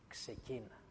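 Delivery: near-whispered, fading repeat of ξεκίνα. Retold or imitated. God repeats to Gideon, fading to a near whisper. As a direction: let ξεκίνα die away almost to a whisper. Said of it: "Του λέει".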